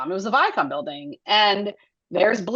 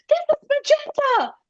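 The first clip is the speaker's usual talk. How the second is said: put-on much higher, sing-song voice for a small child; mom to toddler. A mom showing her three-year-old the voice actor, in a high, cooing voice. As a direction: coo like a mom presenting a surprise.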